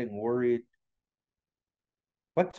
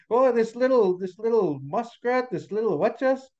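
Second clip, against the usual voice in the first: high, sing-song storybook ridicule; teasing crowd voice, incredulous; mocking amusement; 7 semitones higher than usual. A narrator's reading. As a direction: make fun of the little one, pitch raised. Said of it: "started laughing to themselves and pointing".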